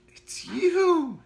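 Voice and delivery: put-on very high, loud boyish cry; excited exclamation. Otto recognizing the gas man, thrilled. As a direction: burst out with delight. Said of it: "he exclaimed".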